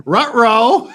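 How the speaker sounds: high, loud laughing cartoon-dog ruh-roh; Scooby ruh-roh. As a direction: yelp it like a cartoon dog in trouble. Scooby-Doo style exclamation.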